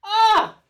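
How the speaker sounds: high, loud fangirl "ah!"; short delighted squeal; swooning cry. Real, not acted. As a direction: cry out in rapture. Swooning over favourite songs.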